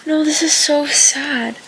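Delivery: high, loud, drawn-out dismay. Real, not acted. Reader reacting to a sad scene, dismayed. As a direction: wail it slowly in protest.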